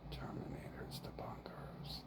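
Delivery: unvoiced whisper of the mantra. Real, not acted. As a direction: breathe the words without voice.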